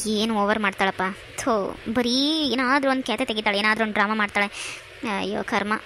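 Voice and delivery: low, quiet sneering mutter under her breath; scornful aside. Acted. Sister-in-law mocks Parvati's fuss under her breath, a low scornful mutter. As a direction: grumble to yourself, mocking her drama.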